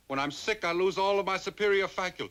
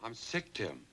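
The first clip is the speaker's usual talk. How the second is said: weak and sickly; 10 semitones lower, 11 dB quieter than usual. Acted. Complaining of illness.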